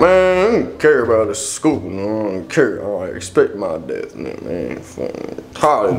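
unbothered, barely intelligible grumble; hard to understand. Acted. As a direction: let it dissolve into muttering. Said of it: "Bro, what are you saying?".

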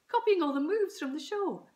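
high, loud gushing mum; excited jabber. A narrator's reading. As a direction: keep gabbling excitedly. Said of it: "jabbered Mum excitedly".

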